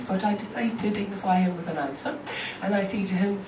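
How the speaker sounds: ordinary delivery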